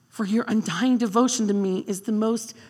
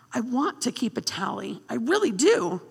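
ordinary talk, nothing marked

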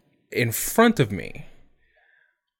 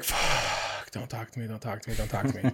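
barely voiced, much softer pleading under the breath; muttered plea; 2 semitones lower, 11 dB quieter than usual. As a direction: mutter it to yourself, hoping not to be noticed. Dreading the missionaries, begging under breath.

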